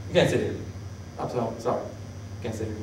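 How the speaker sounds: very soft, low apology fading to a whisper; regretful dismissal. Retold or imitated. God unseating a leader with regret, hushed dismissal. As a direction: let it sink into a sorry near-whisper.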